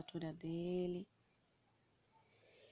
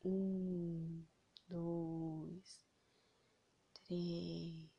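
slow, soft, spaced count; 2 semitones lower, 4 dB quieter, much slower than usual. Shown as a demonstration. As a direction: count each number slowly. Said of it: "esses três segundos não é assim".

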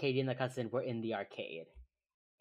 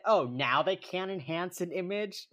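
sarcastic; 5 semitones higher, 5 dB louder than usual. Retold or imitated. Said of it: "Oh, I said".

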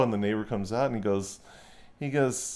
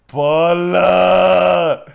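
loud drawn-out call; 9 dB louder than usual. Retold or imitated. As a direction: wail the name one more time. Drunk neighbor yelling for wife.

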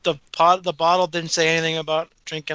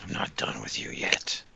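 whisper; 6 dB quieter than usual. Pretend. Whispered movie threat lines.

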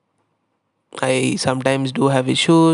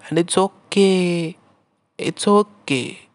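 slow, higher and gently reassuring; nice soothing tone; 5 semitones higher, slower than usual. Acted. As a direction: soothe the listener that all is fine. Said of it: "it's, it's a nice tone".

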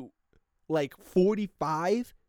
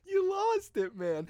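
high, laughing scolding; 13 semitones higher than usual. Real, not acted. Vlogger teasing anyone who says juice, laughing. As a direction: laugh out the verdict.

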